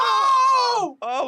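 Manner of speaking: shrill yelping shout; loud high scream. Real, not acted. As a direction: scream out suddenly. Sudden scream after kid's No.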